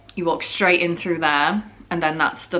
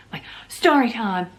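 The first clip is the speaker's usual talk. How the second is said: whisper; 5 semitones higher than usual. Acted. Whispered aside in intro.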